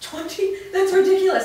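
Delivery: high and loud, stunned disbelief; a sharp, rising burst of disbelief; incredulous outburst. Acted. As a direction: scoff in shock at the idea. Told twenty years have passed.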